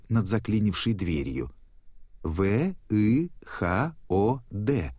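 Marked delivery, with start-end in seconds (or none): 2.2-5.0 slow, each letter drawn out; spelling out letters. Acted. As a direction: read letter by letter, haltingly. Dori reads letters one by one.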